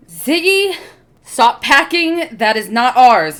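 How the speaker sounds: high, loud scolding call; calling out sharply. Acted. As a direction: shout across the room to stop him. [name] scolds over video call.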